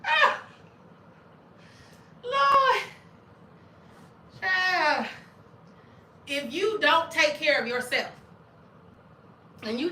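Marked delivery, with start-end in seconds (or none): 0.1-5.1 high, loud cries of Lord; laughing exclamation. Real, not acted. Exclaiming, cracking up.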